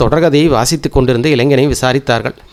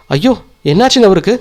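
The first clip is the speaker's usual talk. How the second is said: higher, startled worry; alarmed cry; 5 semitones higher than usual. A narrator's reading. Wife finds her husband collapsed, alarmed. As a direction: gasp it out at the sight of him. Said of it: "பதறினாள்".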